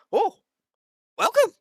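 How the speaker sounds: high, loud burst of greeting; excited welcome. Acted. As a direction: greet them in an eager, high-pitched shout. Scientist NPC greets arriving party.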